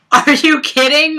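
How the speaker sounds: high, loud, delighted are you kidding; excited disbelief. Retold or imitated. Hearing from the venue that tickets remain, delighted disbelief. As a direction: squeal it in happy shock. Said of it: "I was like".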